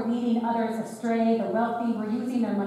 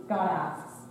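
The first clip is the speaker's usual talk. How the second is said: usual speaking voice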